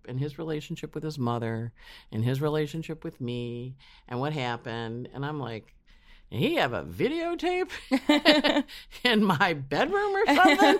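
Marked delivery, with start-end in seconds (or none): 6.3-10.8 high, loud, laughing mid-question; laughing disbelief. Retold or imitated. To the astrologer, laughing amazed disbelief. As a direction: laugh through the astonished question.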